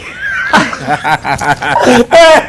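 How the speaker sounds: high, loud burst of laughing; loud laughter. Real, not acted. Laughing at being called Speedy, loud. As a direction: crack up.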